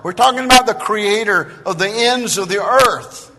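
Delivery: loud, high, ringing sermon voice; full-force preaching. Acted. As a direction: thunder it out to the congregation.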